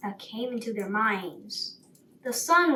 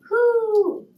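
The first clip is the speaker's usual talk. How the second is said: high loud call of a name; demo volume call; 11 semitones higher, 7 dB louder than usual. Acted. Sparrow showing the squirrel how loud to call. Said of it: "at exactly this volume".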